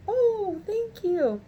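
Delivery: high-pitched grateful coo; touched thanks, imitated. Call center worker thanking a customer, touched. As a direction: imitate someone sweetly taken aback by praise.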